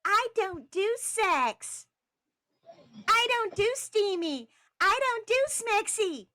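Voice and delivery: very high, loud, slow declarations; emphatic high-pitched yelling; yelling and screaming. Retold or imitated. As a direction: scream each refusal word by word. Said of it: "yelling and screaming is".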